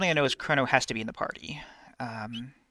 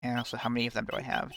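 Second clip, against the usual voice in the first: ordinary talk, nothing marked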